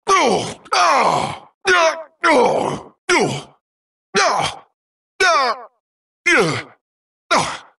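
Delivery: short, high falling cries; sharp exclamations dropping in pitch; high yelps. Acted. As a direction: yelp as a game character would. Series of game hurt cries.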